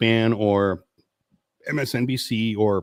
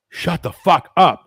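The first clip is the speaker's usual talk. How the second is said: slow, louder, higher yell; drawn-out mock shout, a touch higher; 4 semitones higher, 5 dB louder, slower than usual. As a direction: bark it out slowly. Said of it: "Why do you have to scream all the time".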